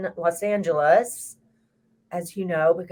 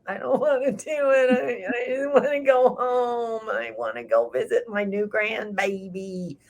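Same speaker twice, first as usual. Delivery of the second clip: whiny reluctance; 4 semitones higher than usual. Retold or imitated. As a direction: play the part of you that wants to go home. Reluctant part of herself voiced.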